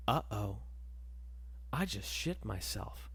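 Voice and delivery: soft, low realisation; quiet dread. Acted. Man feels slippage mid-step, quiet dread. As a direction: say it small and low, to yourself.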